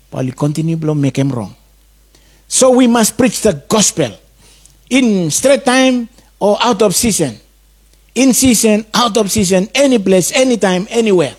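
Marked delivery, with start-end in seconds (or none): 2.5-11.4 raised, higher-pitched preaching push; fervent preaching. Acted. Preacher urges his flock to preach everywhere, fervently. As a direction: drive the call home to the congregation.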